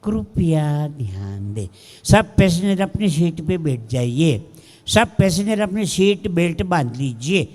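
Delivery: low-pitched, measured Hindi cabin announcement; sweet cabin announcer. Acted. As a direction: imitate an air hostess's syrupy safety announcement. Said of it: "એવું મીઠું મીઠું બોલ્યો".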